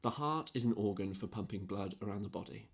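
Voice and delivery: understated pedantic retort, softly delivered; scientific, pedantic. Retold or imitated. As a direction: recite it like a dry fact. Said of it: "Just a very scientific, analytical line".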